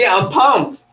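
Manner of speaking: high, loud burst of excitement; pumped up. Shown as a demonstration. Presenter demonstrating excitement to laughing trainees, pumped up. Acting out happy, excited body language.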